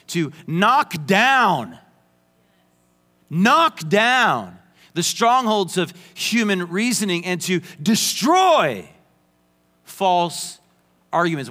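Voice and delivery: slow, punched "knock down" and "destroy"; heavy emphasis. Shown as a demonstration. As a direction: hammer each verb home slowly. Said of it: "Why am I saying it with so much emphasis".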